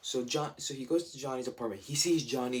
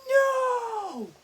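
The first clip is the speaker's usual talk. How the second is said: high, loud, squealing disbelief; shocked squeal; 7 dB louder than usual. Retold or imitated. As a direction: shriek it like the twist just floored you. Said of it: "no, I was like".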